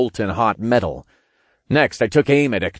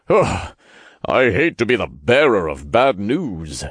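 reluctant sigh in a character voice; groan, then deliver it with weary reluctance. A narrator's reading. Archangel brings bad news to the narrator, sighed. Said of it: "Raphael sighed as he fluttered over".

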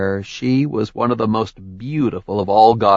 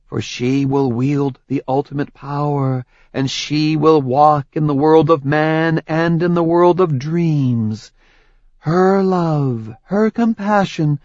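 higher, solemn prophetic goddess voice; prophecy recital; 7 semitones higher, 3 dB louder than usual. Acted. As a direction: intone it like an oracle.

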